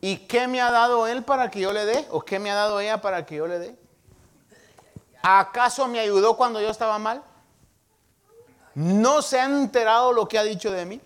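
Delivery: acted indignant objection. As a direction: mimic someone indignantly listing grievances as questions. Acts out resentful objection to helping enemy.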